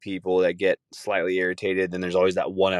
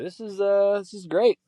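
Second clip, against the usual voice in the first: excited; 12 semitones higher than usual. Real, not acted. Regulars back.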